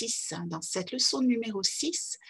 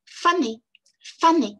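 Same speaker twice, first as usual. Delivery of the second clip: "Faner" with FA higher and louder, ner small; stress on FA; 6 semitones higher, 6 dB louder, slower than usual. Shown as a demonstration. Teacher showing the same rhythm as monkey on "Faner". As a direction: lead with FA in "Faner", then drop. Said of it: "Toujours en souriant bien".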